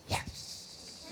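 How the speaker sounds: breathy whispered cheer; a secret whispered triumph; whispered yes. Retold or imitated. Brothers alone on the train, whispered yes.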